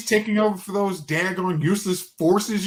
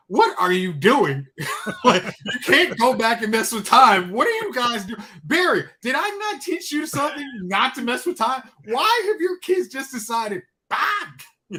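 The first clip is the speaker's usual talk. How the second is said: higher, lightly voiced fed-up mentor with a laugh; mock Jay scolding; 6 semitones higher than usual. Jay scolding the heroes for messing with time, mock exasperated. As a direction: scold them like an exasperated elder, half laughing. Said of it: "Jay's like".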